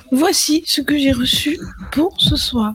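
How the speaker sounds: hushed unvoiced whisper; slow secretive whisper. Real, not acted. Unboxing reveal, whispered.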